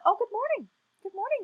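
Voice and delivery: high, friendly greeting; very nice simpleton. Acted. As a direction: answer brightly as a simple, kindly bull. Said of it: "known for being very nice".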